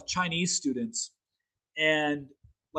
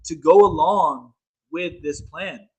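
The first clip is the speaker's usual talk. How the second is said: usual speaking voice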